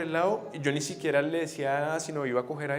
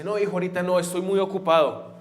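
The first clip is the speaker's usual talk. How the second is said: put-on loud, higher, brushing the child off; annoyed busy father; 5 semitones higher, 10 dB louder than usual. Busy father to son asking for help, loud impatient refusal. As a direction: act a harried dad refusing.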